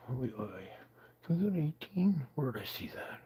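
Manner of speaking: breathy whisper to himself while searching; whispered aside. Real, not acted. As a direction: whisper it under your breath, hunting for a picture.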